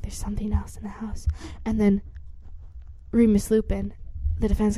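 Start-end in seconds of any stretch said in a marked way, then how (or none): 0.0-1.3 quick hushed whisper; scared whisper. Retold or imitated. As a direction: whisper fast, afraid of being heard. Hearing a noise, scared whisper.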